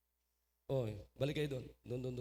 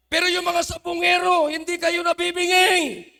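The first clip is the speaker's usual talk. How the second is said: higher and much louder, pounding every line; full-force preaching shout; 9 semitones higher, 9 dB louder than usual. Real, not acted. Preacher mocking listeners who mind his shouting, full-force shout. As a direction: roar it from the pulpit. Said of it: "kasi sumisigaw ako".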